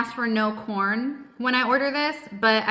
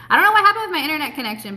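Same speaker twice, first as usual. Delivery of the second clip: fast and higher, exasperated; exasperated rush; 6 semitones higher, 4 dB louder, much faster than usual. Real, not acted. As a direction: blurt it out in a rush. Exasperated about internet dropping.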